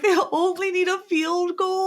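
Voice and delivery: high, tearful disbelief. Retold or imitated. Watching the Bengals, she cries out in tearful disbelief. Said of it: "I just started crying".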